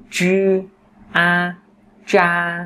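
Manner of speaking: "chư", "a", then "cha", separate high level beats; sounding out. Shown as a demonstration. Teacher joins initial and final, "cha" spelt out syllable by syllable. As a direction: blend unaspirated "chư" and "a" into "cha". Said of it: "chúng ta ghép lại".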